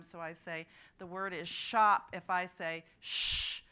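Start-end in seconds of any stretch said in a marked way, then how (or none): 1.5-2.1 shop said loud for the class; teacher modeling a word. Shown as a demonstration. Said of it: "I always use my thumb". 2.1-3.6 shh held alone, slow and loud; isolated onset sound. Shown as a demonstration. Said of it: "I always use my thumb".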